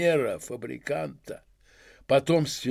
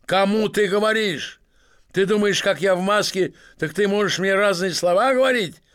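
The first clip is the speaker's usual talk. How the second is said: loud, higher, rowdy merchant roar; drunken bully shouting; 5 semitones higher, 5 dB louder than usual. Acted. Masked drunk merchant yelling at the club guests. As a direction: drive everyone out, bellowing.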